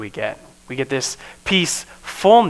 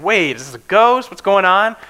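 high, louder alarmed question; spooked disciples; 6 semitones higher, 6 dB louder than usual. Retold or imitated. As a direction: disciples fearing a ghost in the room, alarmed and loud. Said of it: "It's like".

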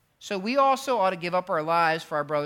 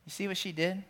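ordinary talk

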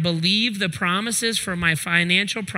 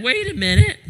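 mock surprise; 11 semitones higher, 6 dB louder, faster than usual. Preacher mocking the crowd's excitement.

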